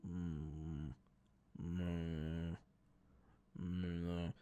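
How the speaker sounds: low, wordless mumble through a wall; muffled low humming drone; muffled calm voice. Retold or imitated. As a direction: imitate an indistinct, calm murmur. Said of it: "I start to hear a muffled voice. Very muffled, very calm".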